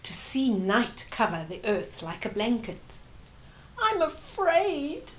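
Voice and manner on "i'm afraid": put-on higher, slow scared little girl; a small girl afraid of the dark; child's frightened cry. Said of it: "Sanele cried to her mother".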